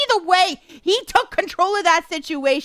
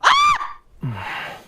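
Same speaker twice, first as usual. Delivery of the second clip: sudden high shriek of surprise; startled shriek. Real, not acted. Shrieking as the character surprises her. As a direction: yelp as the scene catches you off guard.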